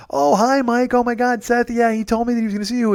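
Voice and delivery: high, excited imitation of a fan; gushing fan. Friend's mom greets Mike on FaceTime, imitated gushing fan. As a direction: greet him high and starstruck. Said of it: "was like a fan".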